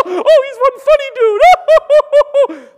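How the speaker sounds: hammed-up, high-pitched shrieking laughter; very loud, slow, exaggerated guffawing shout; losing your mind laughing. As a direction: howl it through huge mock laughter. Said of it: "You lose your mind".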